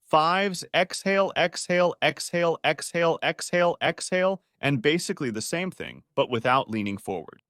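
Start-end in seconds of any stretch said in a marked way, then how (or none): 0.0-4.4 coaching count. Shown as a demonstration. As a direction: call out the exhale like a coach. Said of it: "You stand in line".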